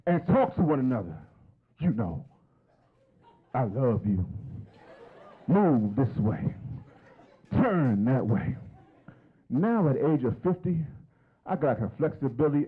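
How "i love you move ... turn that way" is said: slow, tender bedroom murmur; slow seductive voice. Pretend. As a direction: coax her gently, drawn out. Acting out romance with wife.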